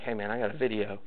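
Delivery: muttered low and hushed, secretive; hushed whisper. Retold or imitated. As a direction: hush it like a forbidden secret. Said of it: "this whispered thing".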